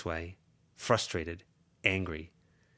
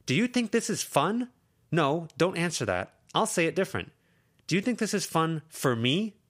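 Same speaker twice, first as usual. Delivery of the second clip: high and louder, upset; heated; 10 semitones higher, 5 dB louder than usual. Acted. Lucy to Verona, heated and upset. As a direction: push back hard. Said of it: "Lucy raised her voice".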